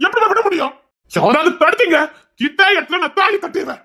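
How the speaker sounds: high, shouted defiance; defiant shout. Acted. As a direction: shout the threat at the whole family. Threatening to tie the thali.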